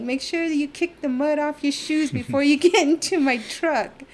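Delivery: high, loud mock scolding; imitated bossy order. Truck owner warning muddy passengers before boarding, loud mock order. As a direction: mimic a loud warning to a whole group.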